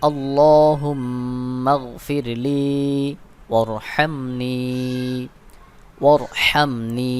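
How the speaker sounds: each word stretched out slowly; slow recitation. Shown as a demonstration. Teacher reciting the supplication slowly for students to follow. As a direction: recite slowly for the learners to repeat. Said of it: "দোয়াটি বানান করে পড়ব".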